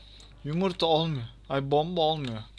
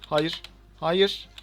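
dismayed cry; 3 semitones higher, 7 dB louder than usual. Real, not acted. Dismay as he loses.

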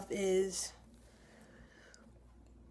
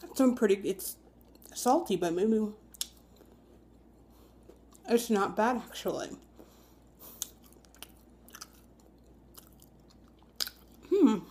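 chewing while talking. Real, not acted. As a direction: mouth partly full. Seen 0: Bites at -5; chews, licks lips, toast at lips while talking.

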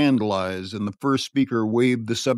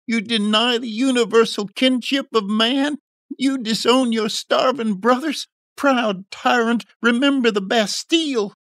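very high, impassioned denunciation; hammed-up revolutionary outcry; screaming; 12 semitones higher, 4 dB louder than usual. A narrator's reading. Drunken youth denouncing a proud tyrant, screaming. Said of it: "he screamed".

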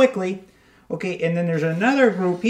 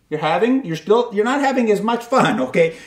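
ordinary talk, nothing marked

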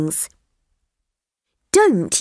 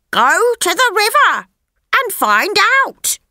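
much higher, fast bird voice; chatty parrot; 13 semitones higher, faster than usual. Acted. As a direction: rattle it off like a quick parrot. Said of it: "it said".

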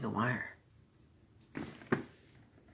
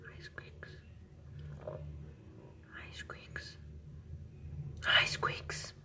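whispering; 11 semitones lower, 6 dB quieter, much slower than usual. Real, not acted. Coaxing shy pet quietly.

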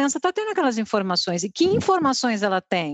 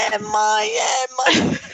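high-pitched mock shout of a kid; imitated child's outcry; 12 semitones higher, much slower than usual. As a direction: cry it out high, playing a shocked son. Imitates shocked son questioning mother. (grito agudo imitando criança)

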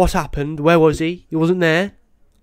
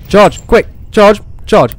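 louder urgent commands, a little higher; urgent yell; 4 semitones higher, 4 dB louder, slower than usual. Real, not acted. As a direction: push the device to hurry. Charging the flashlight in panic.

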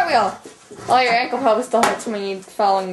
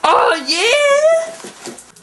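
excited cry; 14 semitones higher, 6 dB louder than usual. Real, not acted.

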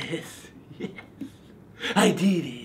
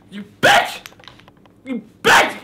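shouting; 5 semitones higher, 3 dB louder than usual. Acted. Raging at pumpkin carving tools.

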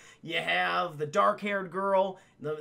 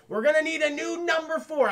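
raised, loud acted outburst; mock exasperated declaration; 9 semitones higher, 5 dB louder than usual. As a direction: announce it high and loud, as a character. Acts Reynolds abandoning a teammate.

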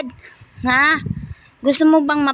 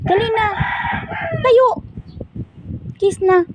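much higher, sing-song coaxing; 10 semitones higher than usual. Real, not acted. Mom urging her pet to kiss her, high and coaxing.

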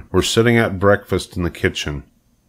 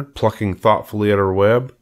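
ordinary delivery, nothing marked

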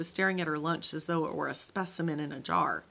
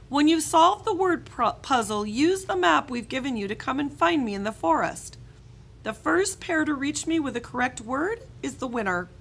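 higher, louder explaining voice; camp leader voice; 7 semitones higher, 7 dB louder than usual. Acted. Camp leader giving treasure hunt rules, raised voice. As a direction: address a group of kids outdoors.

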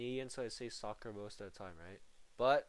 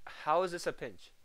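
louder, higher complaint; exasperated rant; 5 semitones higher, 9 dB louder than usual. Real, not acted. Streamer explaining the bad pinch to chat, exasperated. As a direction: vent at the broken physics.

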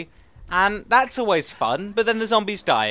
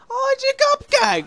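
very high, drawn-out happy squeal; delighted squeal; slower than usual. Real, not acted. Son sees the cupcake his mum brought, delighted squeal. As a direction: squeal it slowly, thrilled by a treat.

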